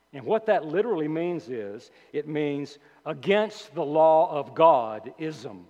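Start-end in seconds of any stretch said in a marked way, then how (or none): none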